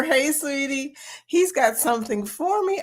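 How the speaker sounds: ordinary talk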